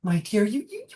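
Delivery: put-on high-pitched old mother, pleading tenderly; high, earnest motherly urging; old woman. Old mother urging grieving daughter to move on, high pleading voice. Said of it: "the old woman says".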